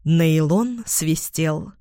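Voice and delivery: usual speaking voice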